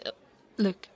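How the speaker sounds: ordinary delivery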